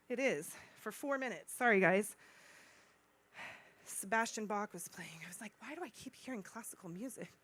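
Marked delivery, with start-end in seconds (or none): 3.8-7.3 low, whispery aside; hushed aside. Real, not acted. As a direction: drop to a near-whisper, much softer. Sheepish aside about phone alarm.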